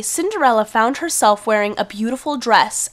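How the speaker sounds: ordinary talk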